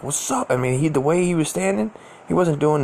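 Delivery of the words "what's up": high, rising retold challenge. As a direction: throw it out high as a question. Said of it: "He's like".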